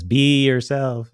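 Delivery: Genie. Acted. Genie turned into a bee advising Aladdin, slow and corny. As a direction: stretch it out as corny cartoon wisdom. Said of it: "the genie turns into a bee and says".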